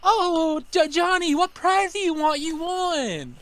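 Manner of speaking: high, mocking cheer for a child who lost; mock parent voice. Doting parent to her kid, mock cheer. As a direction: ham up a doting parent announcing a win.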